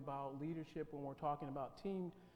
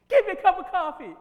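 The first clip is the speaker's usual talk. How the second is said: much higher, very loud, unhinged; psycho; 22 dB louder than usual. Shown as a demonstration. Teacher shows class a crazed, screaming coffee order. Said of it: "What did I just communicate that time?".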